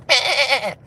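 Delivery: repeated high bleating; sheep bleat. Acted. Mocking vaccinated friends as sheep, high repeated bleats. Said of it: "I can do really good sheep impressions".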